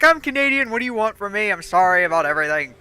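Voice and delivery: mock defensive protest. Canadian defending her apologies to friend, mock defensive outburst. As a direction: protest loudly, then let it sink. Retold defensive comeback, pitch falling.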